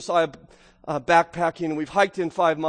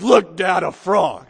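put-on proud little boy; 7 dB louder, slower than usual. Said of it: "goofy grin on his face".

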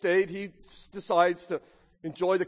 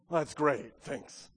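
sarcastic; 4 semitones lower, 5 dB quieter, slower than usual. Pretend. Mock reply to lazy investor.

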